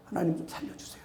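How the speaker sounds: breathy whispered prayer; barely voiced whisper begging for help; whispered plea. Shown as a demonstration. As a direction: whisper it to God, pleading. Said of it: "목사도 그러는데 저도".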